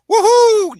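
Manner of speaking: high, loud cheery whoop; mock Spider-Man woohoo; gleeful whoop. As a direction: whoop it like a giddy hero. Excited Spider-Man cheer in retelling.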